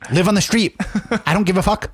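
high-pitched mock hard-ass advice; Gary Vee. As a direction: deliver each dismissal as an exaggerated guru verdict. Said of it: "He's just such a hard ass".

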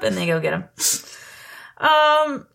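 usual speaking voice

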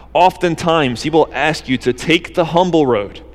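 ordinary delivery

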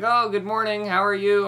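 lightly sunny mother voice; imitating mom. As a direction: chirp it like a bright mom. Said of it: "My mom's like".

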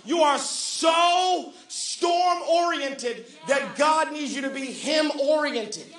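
emphatic preacher drawing out key words; fervent preaching. Real, not acted. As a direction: press the point on the congregation.

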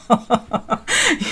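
a short real laugh to herself; real, easy laugh; amused laugh. Real, not acted. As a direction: laugh at the thought. Laughing at own comparison.

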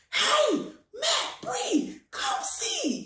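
high, excited call to the family; shouting for the kids to come look. Retold or imitated. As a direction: call them over, thrilled. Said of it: "I was like".

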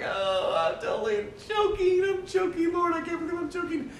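high, strained squeal of self-reproach; mock inner scream. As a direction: squeal it like screaming inside your own head. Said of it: "screaming inside my head".

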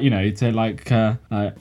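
ordinary talk, nothing marked

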